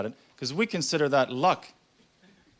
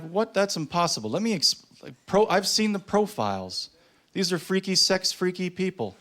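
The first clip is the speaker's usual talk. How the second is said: high-pitched, stumbling protest; mock incredulous; 7 semitones higher than usual. Boyfriend disputing girlfriend's story about profiles, incredulous. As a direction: argue back in comic disbelief. Acted quarrel, boyfriend disbelieving.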